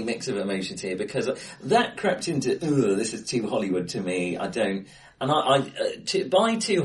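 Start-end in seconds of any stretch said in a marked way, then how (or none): none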